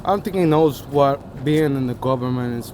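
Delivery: ordinary talk, nothing marked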